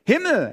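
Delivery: Himmel said high and loud, falling off; stress on Himmel. Shown as a demonstration. Preacher stresses Himmel to the church, high and loud. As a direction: hit Himmel hard and high. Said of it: "die Betonung liegt auf".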